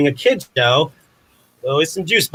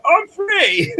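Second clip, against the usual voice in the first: much higher, mock triumphant cry; Born Free lion. Explaining the Born Free joke, mock lion's cry of freedom. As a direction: quote the lion with a big joyful yell. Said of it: "It's from Born Free".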